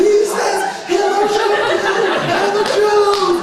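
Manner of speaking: high, full-volume chasing scream. Retold or imitated. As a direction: scream it while running after someone. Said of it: "And scream all the way".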